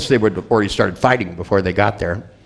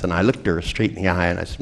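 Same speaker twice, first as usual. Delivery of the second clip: ordinary delivery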